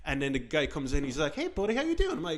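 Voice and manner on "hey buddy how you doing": high, mock-friendly greeting; imitated over-friendly cop; good cop. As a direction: greet chummily in a pitched-up voice. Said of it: "I'll be good cop first".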